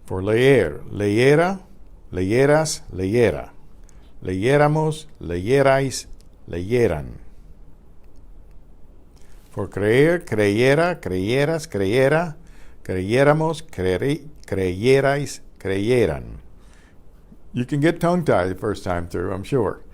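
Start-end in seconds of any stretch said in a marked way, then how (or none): 0.9-7.2 leyera said clearly as le-ye-ra, the y sounded; Spanish teacher modeling leyéramos with stress on the ye; careful Spanish drill. Shown as a demonstration. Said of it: "read them, pronounce them out loud". 10.2-16.4 creyera spoken syllable by syllable, the y sounded; teacher drilling the forms of creyera; careful Spanish drill. Shown as a demonstration. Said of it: "read them, pronounce them out loud".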